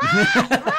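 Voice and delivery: high, loud crashing wave cry; wave crash shout. Pretend. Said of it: "Sounds of the, the sea".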